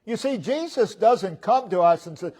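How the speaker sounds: normal talking voice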